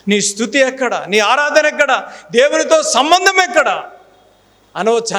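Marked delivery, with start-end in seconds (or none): 0.1-3.8 each question higher and louder; building preaching questions. Acted. (క్రమంగా పెరిగే బోధనా ప్రశ్నలు)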